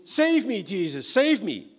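high, loud cry for help, slowing at the end; desperate cry. Acted. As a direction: call out as if going under. Peter sinking, crying for help.

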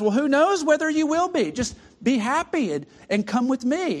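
the murderer urging the ghost to come along; inviting murderer. Acted.

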